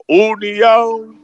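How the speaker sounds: drawn-out call of the stop name; slow, drawn-out shout of União; driver calling out loud. Retold or imitated. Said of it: "ele fala bem alto".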